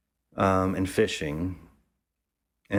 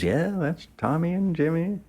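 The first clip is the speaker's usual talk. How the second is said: much higher imitated woman's voice; proud mom voice, pitched way up; matronly woman; 10 semitones higher than usual. The mother introducing her sons, in a high put-on voice. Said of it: "she goes".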